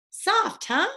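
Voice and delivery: high, teasing lilt; a lightly voiced teasing girl; teasing little sister. A narrator's reading. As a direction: needle him playfully.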